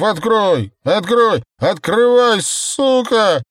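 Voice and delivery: put-on high, loud, dragged-out drunken yells; drunken shouting. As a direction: wail and curse at the closed door. Drunk man sitting at door yelling. (пьяные вопли)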